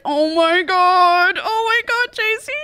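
high, slow awestruck gasping; starstruck squeal. Retold or imitated. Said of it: "And she's like".

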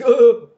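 loud, high hammed-up "oh"; mock caught-out "oh". Comedian mocking man who was caught, exaggerated "oh". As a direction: blurt a helpless exposed noise.

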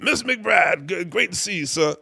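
high-pitched, effusive mock greeting; put-on high voice, formally effusive. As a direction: imitate a showman greeting a guest. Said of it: "He's like".

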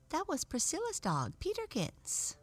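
very high doll voice; French doll. A narrator's reading. French doll telling whose dog it was, high-voiced. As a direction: pitch it up high as a little doll.

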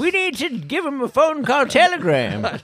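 put-on high, creaky old-timer voice; old man voice. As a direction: play a cranky old geezer. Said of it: "do your old man voice".

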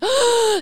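a sharp, high startled gasp; Yulan, caught by the lake, gasps in fright. Retold or imitated. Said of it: "吓到".

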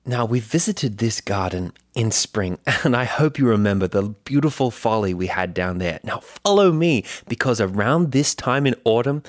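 put-on BBC garden presenter in a British accent; British gardens reporter. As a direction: play an earnest British TV gardening host. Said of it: "one is a B B C Gardens reporter".

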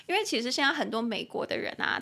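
ordinary talk, nothing marked

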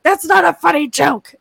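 put-on lightly scolding a small child; stern mom. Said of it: "I was like".

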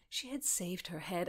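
ordinary delivery

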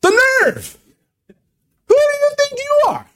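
high, affronted exclamation; indignant outrage. Acted. Blind man offended at people talking about him, high indignant outburst. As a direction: bristle at the insult, voice shooting up.

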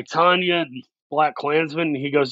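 usual speaking voice

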